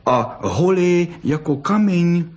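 put-on high, slow childlike amazement; little cub marvelling; surprised. A narrator's reading. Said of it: "prohodila překvapeně peřiderka".

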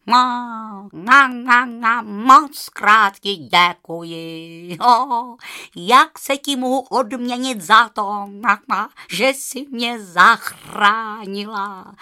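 loud, slow honking goose voice; cackling goose. Acted. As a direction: honk out the thanks like a goose. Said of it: "zakejhala husa". (husa kejhá hlasitě a vděčně)

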